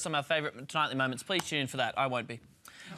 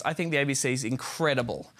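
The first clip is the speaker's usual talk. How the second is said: ordinary delivery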